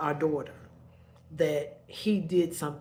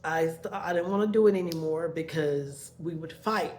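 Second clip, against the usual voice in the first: normal talking voice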